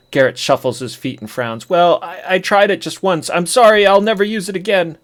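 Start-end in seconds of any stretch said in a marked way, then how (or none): 1.7-5.0 put-on higher, pleading boy's voice; whiny kid confession. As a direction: beg forgiveness like a caught kid. Kid confesses, begging sorry.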